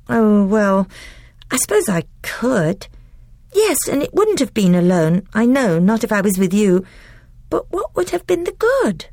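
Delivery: put-on high, quick, defensive little girl; a child excusing herself in a rush; young girl Lucy. A narrator's reading. Voices Lucy.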